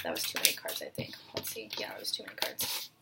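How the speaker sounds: very quiet, half-whispered aside to self. Real, not acted. Reader checking the fallen cards to herself, whispered aside. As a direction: drop to an almost voiceless murmur.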